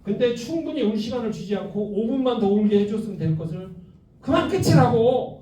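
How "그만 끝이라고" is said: slow, higher, louder mock scold; exasperated mother hammed up. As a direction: imitate a parent cutting crying short. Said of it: "이렇게 해갖고".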